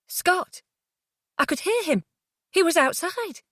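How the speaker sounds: high-pitched, breathless-with-hope excitement; sudden joyful hope, pitch soaring; thrilled realisation; elated disbelief. A narrator's reading. Hears lost lover's voice, elated.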